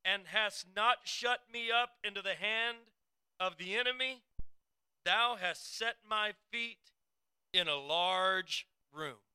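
high, loud, slow preaching read; scripture reading. Acted. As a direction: proclaim each verse like a sermon from the pulpit. Rereads verse eight emphatically.

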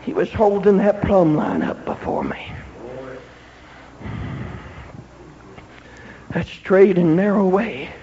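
low, soft, weighty sermon voice; hushed preaching. Acted. Preacher pointing congregation to the plumb line, hushed. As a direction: let it drop low, with long pauses.

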